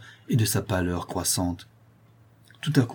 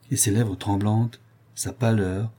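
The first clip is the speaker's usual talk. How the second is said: ordinary delivery, nothing marked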